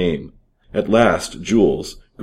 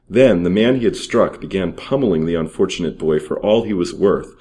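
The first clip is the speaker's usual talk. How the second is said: plain reading voice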